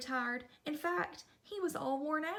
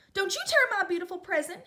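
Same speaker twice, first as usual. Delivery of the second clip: fast, higher, much louder shout; 6 semitones higher, 8 dB louder, faster than usual. Acted. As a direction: yell to save the scarf. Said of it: "he shouted".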